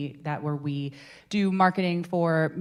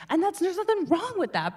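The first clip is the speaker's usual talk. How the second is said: ordinary delivery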